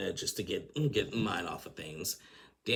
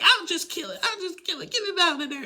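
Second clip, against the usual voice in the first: very high squealed yell, imitating; mock scream; 7 dB louder than usual. Lisa screaming about killing the spider, very high. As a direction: squeal it, half laughing. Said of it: "screaming at each other".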